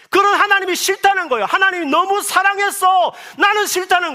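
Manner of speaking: high, loud, impassioned sermon; fervent preaching. Acted. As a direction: preach it with fire. Preaching at full force. (높고 힘찬 목소리로 열정적으로 설교하듯)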